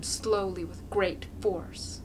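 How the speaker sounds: ordinary delivery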